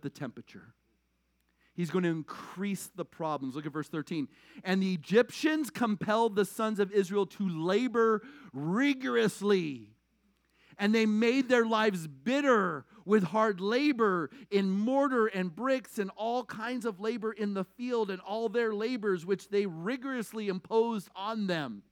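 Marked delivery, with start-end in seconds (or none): 4.6-8.2 slow, weighty Bible reading; scripture reading. Acted. Reads scripture aloud. 8.5-10.0 rigorously drawn out slowly, falling; stress on rigorously. Acted. Stretches the key word. 10.8-21.8 slow, weighty Bible reading; scripture reading. Acted. Reads scripture aloud.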